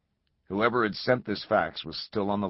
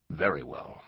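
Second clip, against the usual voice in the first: ordinary delivery, nothing marked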